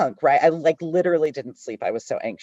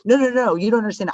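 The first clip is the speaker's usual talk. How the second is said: high-horse protest; 7 semitones higher, 4 dB louder, faster than usual. Retold or imitated. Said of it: "so on my high horse and just like".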